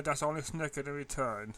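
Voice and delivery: plain reading voice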